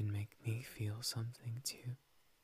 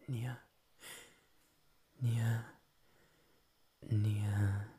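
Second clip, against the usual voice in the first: cutesy nya speak; 3 dB louder, much slower than usual. Acted. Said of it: "when I talk like a cat".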